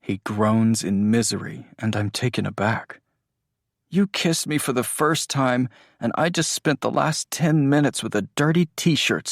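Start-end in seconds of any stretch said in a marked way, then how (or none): 3.9-9.3 put-on a bit higher, complaining boyfriend; half-laughing grumble about the gag; lightly voiced Andrew, mock annoyed. Narrator voices Andrew griping after the kiss, higher.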